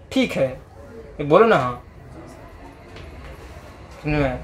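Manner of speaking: ordinary talk, nothing marked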